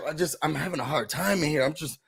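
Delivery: quietly imitating a lost guy; mumbling mock houseguest. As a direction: mumble like a confused bro. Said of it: "he's like".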